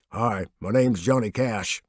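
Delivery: a hint of a deep humble rumble. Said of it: "His voice rumbled and resonated".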